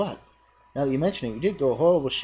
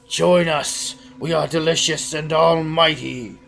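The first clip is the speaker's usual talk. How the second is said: booming cult call; 3 semitones higher, 7 dB louder, slower than usual. Acted. Cheese cult to the hero, loud grand summons. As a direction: announce godlike power.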